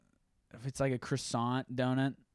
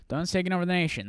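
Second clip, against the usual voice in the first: announcer sign-off; 8 semitones higher, 5 dB louder, much faster than usual. Shown as a demonstration. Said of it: "That's how I'm ending this podcast".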